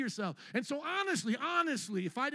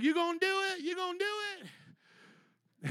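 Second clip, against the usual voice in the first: mock hype preacher; 9 semitones higher than usual.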